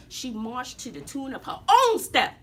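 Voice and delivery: ordinary delivery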